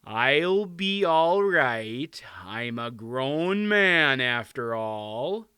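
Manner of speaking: very slow, drawn-out embarrassed king; awkward, slow reassurance. A narrator's reading. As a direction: protest sheepishly, stretching each word. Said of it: "said in an embarrassed voice".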